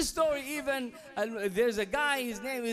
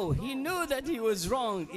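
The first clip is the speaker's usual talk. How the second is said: ordinary talk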